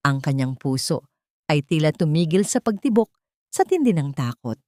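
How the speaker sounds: ordinary delivery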